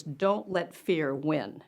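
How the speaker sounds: very slow, deliberate words; son's firm advice. Retold or imitated. Son urging his mom on, slow earnest firmness. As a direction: weigh each word slowly. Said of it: "he says".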